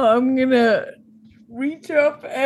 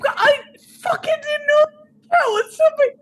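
much higher, flustered protest; panicked in character; 13 semitones higher than usual. Acted. Player defending himself for opening the door, high and panicked. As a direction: squeal the excuse, voice way up. Acted quarrel over opened door.

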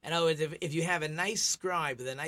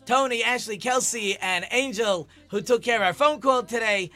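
louder, upbeat announcer outro; radio host sign-off; 6 semitones higher, 7 dB louder than usual. Acted. Rabbi thanks sponsors and listeners in a loud radio sign-off. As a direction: wrap up the show like a radio host.

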